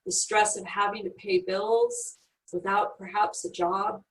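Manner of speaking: calm, soft guiding voice, unhurried; guided meditation. Acted. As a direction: lead them gently inward.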